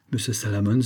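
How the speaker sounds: ordinary delivery, nothing marked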